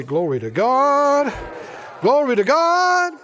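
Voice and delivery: loud, high call, drawn out at the last; rising praise shout. Real, not acted. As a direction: build to a long, ringing shout of praise. Congregation called to shout praise.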